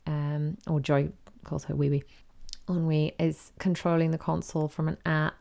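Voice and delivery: usual speaking voice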